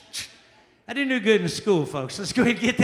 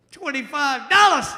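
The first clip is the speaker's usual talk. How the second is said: mock outrage; 7 semitones higher, 9 dB louder, slower than usual. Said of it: "repeating gambler".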